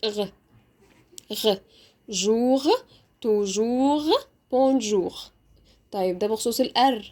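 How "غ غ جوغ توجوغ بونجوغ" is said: isolated "غ", then "جوغ" with the same throaty ending; guttural French R. Shown as a demonstration. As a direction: rasp the R of "توجوغ" at the back of the throat. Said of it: "يبقى أنا نطقت ال R".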